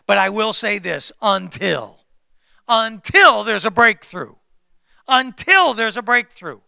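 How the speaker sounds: loud, driving sermon voice; fired-up preacher pounding his points; full-force preaching. Acted. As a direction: preach it out to the whole room.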